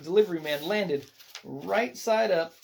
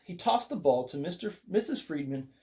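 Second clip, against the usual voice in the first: plain reading voice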